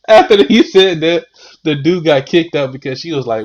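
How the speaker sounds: normal talking voice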